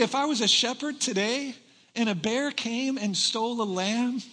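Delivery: ordinary talk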